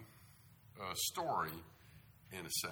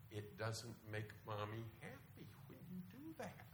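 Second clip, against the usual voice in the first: very calm mother; 9 dB quieter than usual. Retold or imitated. Preacher imitating mother talking to tantruming child, very calm. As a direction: imitate a mother softly placating her child. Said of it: "she's very calmly saying".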